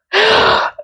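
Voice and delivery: short sharp high laugh; scoffing laugh at the city officials; high incredulous laugh. Real, not acted. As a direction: laugh in disbelief.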